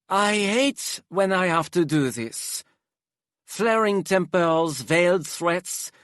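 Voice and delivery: high, theatrical aristocrat; dramatic Hypnos voice. Acted. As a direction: flamboyant and weary, sighing exaggeratedly.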